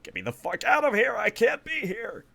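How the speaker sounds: high, fast, frantic plea; bug-eyed panic, voice shooting way up; panicked freak-out. Acted. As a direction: freak out in a squealing rush to escape. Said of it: "freaking out, bug-eyed".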